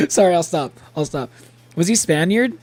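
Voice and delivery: usual speaking voice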